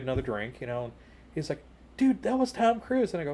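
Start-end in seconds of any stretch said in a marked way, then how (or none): 2.0-3.1 high-pitched fanboy disbelief; starstruck amazement. Retold or imitated. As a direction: imitate an awestruck friend, pitched way up. Starstruck friend versus unimpressed teller.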